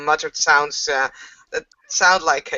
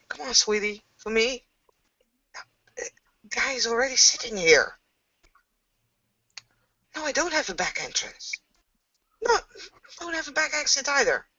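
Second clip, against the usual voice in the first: Eric pleading; 8 semitones higher, 5 dB quieter than usual. Acted. Eric pleading on phone behind curtain.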